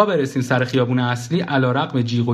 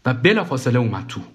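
ordinary delivery, nothing marked

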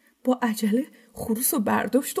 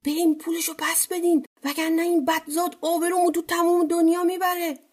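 raised-pitch order to the ministers; the amir, higher and urgent; lightly voiced amir; 6 semitones higher than usual. A narrator's reading. Narrator voices the worried amir. (صدای کمی زیرتر امیر)

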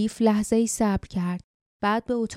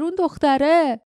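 اون دختره yelled much higher and louder; اون دختره shouted, louder than the order before; rough shout; 8 semitones higher, 6 dB louder than usual. A narrator's reading. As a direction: bark out اون دختره with a falling end. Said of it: "صدای خشنی فریاد زد".

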